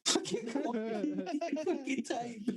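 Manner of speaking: high, drawn-out words through laughter; helpless laugh breaking up the words. Real, not acted. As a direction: crack up while saying it. Cracking up at his own story.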